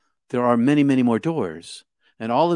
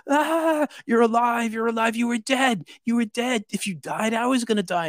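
high, wailing imitation of a distraught friend; imitated crying; 13 semitones higher than usual. Friend finds his partner alive after all, imitated crying. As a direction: wail it high, as if crying with relief. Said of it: "he's crying".

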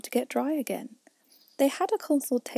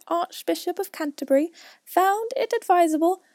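high, loud history lecture; pompous mouse reciting; 7 semitones higher, 4 dB louder than usual. A narrator's reading. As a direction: press on with the lecture grandly.

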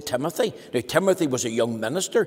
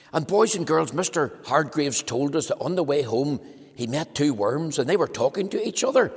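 ordinary talk

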